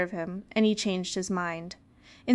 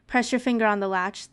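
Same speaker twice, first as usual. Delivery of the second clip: ordinary delivery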